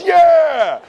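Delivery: high loud yeah; group cheer. Retold or imitated. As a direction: cheer along with the crowd. Everybody cheering.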